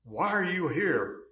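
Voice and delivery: high, loud imitated yell; retold screaming. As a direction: shout it like he owned the place. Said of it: "he was screaming".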